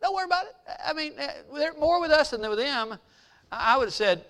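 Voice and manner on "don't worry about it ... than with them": high-pitched, casual mock reassurance; light, high imitation of a carefree prophet; unworried prophet. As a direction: brush off the worry in a high voice. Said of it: "When Elijah said".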